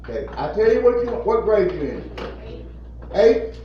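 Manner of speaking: ordinary delivery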